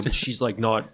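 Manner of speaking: ordinary talk, nothing marked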